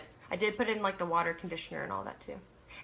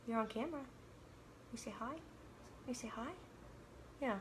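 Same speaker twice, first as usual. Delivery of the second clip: much softer coaxing voice; hushed, soft pet talk; 3 semitones higher, 14 dB quieter, faster than usual. Real, not acted. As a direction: murmur softly to the dog. Softly coaxing the dog.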